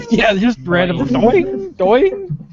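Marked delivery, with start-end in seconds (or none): 1.4-2.4 high springy "boing" noise; imitated censor boing. Host mimicking Dale's censorship noise, springy boing. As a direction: imitate a cartoon bleep-out, pitched way up. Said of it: "do his censorship noise to it".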